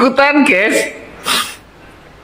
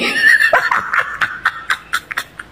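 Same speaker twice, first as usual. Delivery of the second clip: silent breathless laughter; 5 dB quieter than usual. Real, not acted. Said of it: "Aku ini sampai ketawa, perutku sakit".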